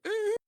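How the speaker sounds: tiny high-pitched whimper; thin, very high whine, played for laughs; mock whimper. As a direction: imitate a scared kid's squeak. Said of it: "like, whimpering".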